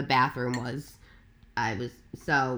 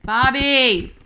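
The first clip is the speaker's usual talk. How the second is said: higher, louder shout across the room; calling out; 9 semitones higher, 9 dB louder than usual. Real, not acted. Host calling to Bobby to check the recording, loud. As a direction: call out to get Bobby's attention.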